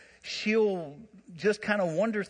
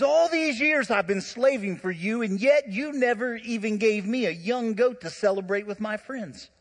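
raised, aggrieved quote of the brother; indignant protest; 6 semitones higher, 4 dB louder than usual. Acted. Older brother complaining to his pleading father, aggrieved and raised. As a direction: throw the grievance back at the father.

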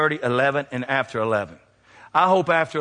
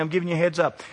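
ordinary talk

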